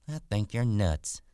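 put-on wary young wife. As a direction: play a wife lightly put off. Voices Curley's wife.

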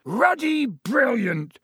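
slow, high booming shout. A narrator's reading. Hagrid over the heads of the crowd, booming. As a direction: boom it out, stretching each word. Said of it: "boomed Hagrid".

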